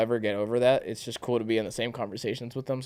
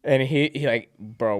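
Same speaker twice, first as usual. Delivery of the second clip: normal talking voice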